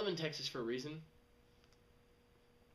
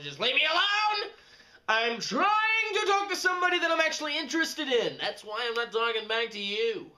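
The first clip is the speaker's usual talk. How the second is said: much higher, louder exasperated outburst; fed-up yell; 11 semitones higher, 11 dB louder than usual. Retold or imitated. Annoyed user yelling at a pushy chatter in exasperation. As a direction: snap it out, totally fed up.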